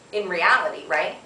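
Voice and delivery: ordinary talk, nothing marked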